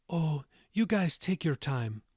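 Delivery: unhurried Irish grandma voice; moderate Irish accent. A narrator's reading. Tilda reassures daughter and son-in-law, moderate Irish accent. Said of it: "in her moderate Irish accent".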